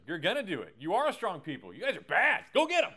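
rally them like a coach before the big game; mock pep talk, building to a shout; locker room coach. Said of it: "It's, it's the coach in the locker room".

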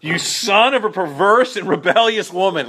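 high, laughing, loud reading of the insult; laughing mock curse. Pastor savoring Saul's insult to the class, laughing. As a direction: relish the insult through a laugh. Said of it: "I don't know if you can cuss in Hebrew".

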